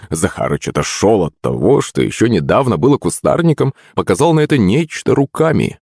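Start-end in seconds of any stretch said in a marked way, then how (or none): none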